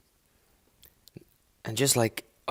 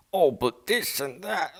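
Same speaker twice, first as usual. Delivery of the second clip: higher-pitched mock rambling guy; mocking imitation; 5 semitones higher than usual. Mimicking a guy rambling at them.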